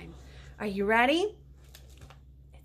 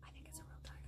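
soft unvoiced stage whisper; 4 semitones higher, 25 dB quieter, much faster than usual. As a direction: share a secret with the kids. Aside to the kids.

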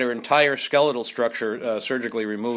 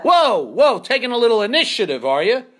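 sarcastic reprimand; 10 semitones higher, 6 dB louder than usual. Retold or imitated. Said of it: "And I said".

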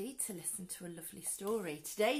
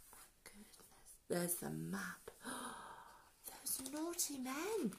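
hushed gasp of alarm; hushed mock-shocked whisper; 9 dB quieter than usual. Reader reacts to map.

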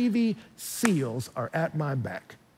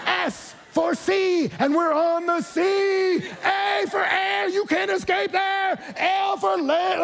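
slow, much higher, shouted taunt spelling out SEAL; mocking shout; slower than usual. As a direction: gloat and yell each letter down at him. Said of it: "he doesn't start mocking this guy".

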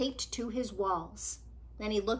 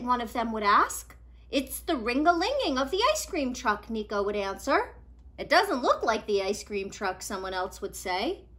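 put-on lively read-aloud for kids; storybook reading; 3 semitones higher, 4 dB louder than usual. A narrator's reading. Reader telling children a picture book story, lively.

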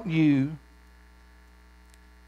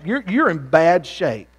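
ordinary talk

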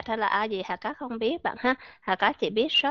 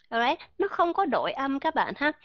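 ordinary talk, nothing marked

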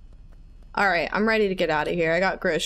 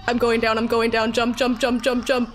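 higher, panicked rapid repeats; frantic urging; 4 semitones higher, 3 dB louder than usual. Real, not acted. Player jumping down to escape, frantic urging. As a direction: urge yourself on in a panic. Urging self to jump from monster.

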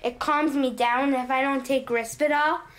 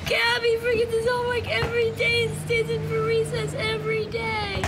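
crying; 8 semitones higher than usual. Real, not acted. Child upset, arguing with mother.

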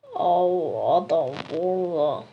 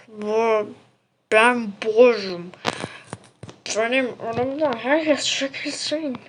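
character voice; 4 dB louder, slower than usual. Acted. Game character voiced by player.

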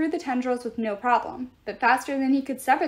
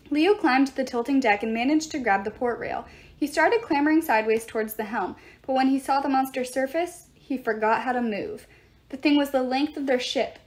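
usual speaking voice

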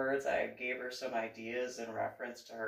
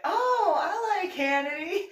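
a much higher, louder fake-thrilled "oh"; mock delight; 9 dB louder than usual. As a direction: play along with exaggerated cheer. His own polite reply.

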